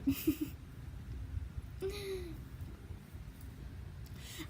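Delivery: soft airy giggling; breathy giggle. Real, not acted. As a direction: let a hushed giggle out. Laughing at own hiccups.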